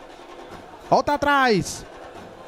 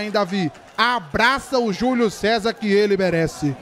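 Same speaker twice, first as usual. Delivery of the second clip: usual speaking voice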